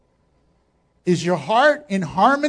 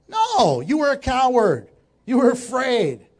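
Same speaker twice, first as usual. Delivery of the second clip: emphatic rebuke; 3 dB louder than usual. Acted. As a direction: cut the excuse down with mock outrage. Acted rebuke of the excuse-maker.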